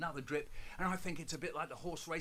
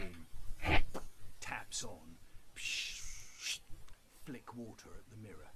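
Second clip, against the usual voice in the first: whisper; 6 semitones lower, 5 dB louder, slower than usual. Retold or imitated. Sneaking in bathroom.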